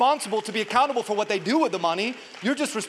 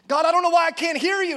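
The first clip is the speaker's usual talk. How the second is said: high, loud imitated plea; mock complaint to God; 9 semitones higher, 6 dB louder, faster than usual. Believer complains to God he hears nothing, loud mock plea. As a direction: cry it upward, acting out frustration. Voices a frustrated person praying.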